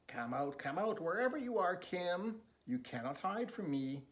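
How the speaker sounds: singsong taunt, rising high and loud; taunting villain. Acted. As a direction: call out like a stalker toying with prey. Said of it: "the man running around".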